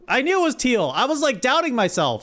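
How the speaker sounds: high, animated exclamation; excited vindication. Real, not acted. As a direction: crow that you knew it all along. Just found the pronunciation.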